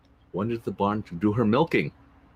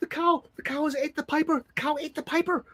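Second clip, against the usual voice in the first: high-pitched horrified wail; panicked wife. Acted. Wife telling husband the cow ate the piper, panicked. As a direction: cry out in horror.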